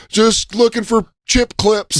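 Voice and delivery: high, slow, falsely innocent. Retold or imitated. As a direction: play it innocent, caught out.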